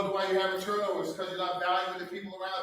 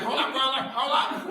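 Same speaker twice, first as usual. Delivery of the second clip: lightly voiced colleague, a touch higher; playful imitation of a fired-up colleague; colleague's hold up; 5 semitones higher, 3 dB louder than usual. Superintendent imitating Ann telling him to wait, light mock voice. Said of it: "She's like".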